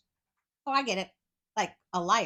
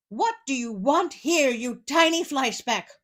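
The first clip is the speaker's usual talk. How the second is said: loud, raised giant voice; roaring giant; 5 semitones higher, 6 dB louder than usual. Acted. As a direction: bellow it at the tiny tailor. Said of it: "roared the giant".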